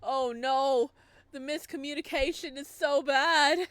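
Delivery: usual speaking voice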